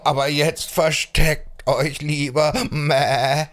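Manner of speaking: wavering goat voice; friendly warning billy goat. Acted. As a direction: bleat a warning and farewell. Ziegenbock warnt und verabschiedet sich. (warnender Ziegenbock mit Meckerstimme)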